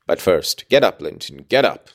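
ordinary delivery, nothing marked

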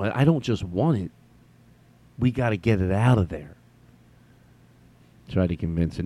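ordinary delivery

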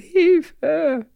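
high, mock child's call for help; child calling a parent for help, high. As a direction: call out like a small kid. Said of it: "wenn mein Kind ruft".

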